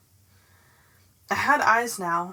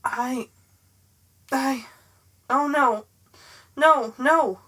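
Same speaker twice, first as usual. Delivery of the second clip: panicked stammer; 4 semitones higher, 5 dB louder, slower than usual. A narrator's reading. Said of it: "Rachel's thought speak voice".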